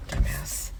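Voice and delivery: low scornful aside; muttered insult. Real, not acted. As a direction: mutter it under your breath with contempt. Muttered insult after story.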